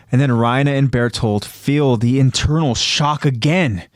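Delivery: usual speaking voice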